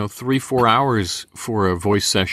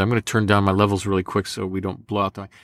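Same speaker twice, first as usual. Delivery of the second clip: normal talking voice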